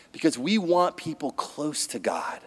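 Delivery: hushed, breathy confiding whisper; whispered conviction. Real, not acted. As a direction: drop to a secret whisper. Sudden hushed aside.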